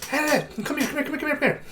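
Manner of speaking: high-pitched NPC calling out. Acted. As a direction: call them over, voice up high. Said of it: "He was like".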